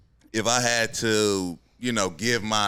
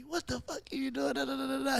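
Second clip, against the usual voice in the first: imitating a coach chewing him out, pitched high; mock high-voiced coach yell; 7 dB quieter, faster than usual. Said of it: "He's like".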